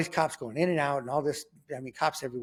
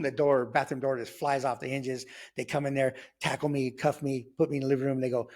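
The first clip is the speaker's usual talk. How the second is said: normal talking voice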